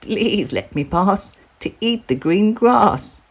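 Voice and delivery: a tiny goat setting off bravely; littlest billy goat. Acted. Littlest goat announcing he will cross, high and small.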